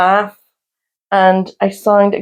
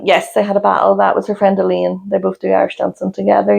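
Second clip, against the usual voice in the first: ordinary talk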